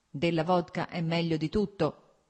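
plain reading voice

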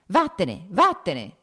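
loud, high yell of get out; furious shout. A narrator's reading. Nikolai rising from his chair, yelling at his brother. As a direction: bellow it as you jump up. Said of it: "gridò alzandosi dalla sedia".

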